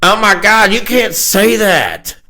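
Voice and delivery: high, drawn-out imitation of an objection; mock scandalized. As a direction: protest in a high, stretched-out voice. Acting out the old lady's objection.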